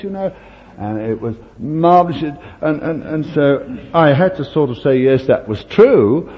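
ordinary delivery

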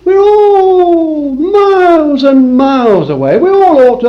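high, slow, shouted preaching. Acted. Preacher crying out to the congregation, shouting slowly. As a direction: cry it out slowly to the back row.

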